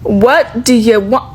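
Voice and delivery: ordinary talk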